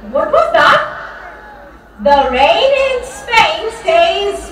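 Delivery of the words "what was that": normal talking voice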